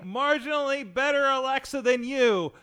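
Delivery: calling out. Real, not acted. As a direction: call it out across the room, high and loud. Calling out to Alexa device.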